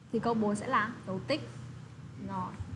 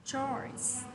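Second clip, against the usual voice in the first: normal talking voice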